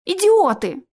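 high, loud exclamation; exasperated scolding. Acted. Alisa cuffs both squabblers, exasperated. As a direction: scold them in one burst.

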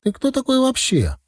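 high-pitched confrontational question; squaring up, pitched high; indignant challenge. A narrator's reading. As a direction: demand to know who he is. Roommate challenging him in quarrel.

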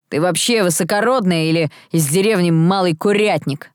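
ordinary delivery

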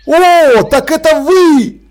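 high, loud, slow "О, так это вы!"; a delighted gasp of recognition, drawn out; surprised and delighted. A narrator's reading. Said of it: "сказал Деслов".

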